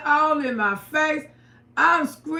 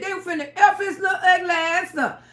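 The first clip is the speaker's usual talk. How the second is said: normal talking voice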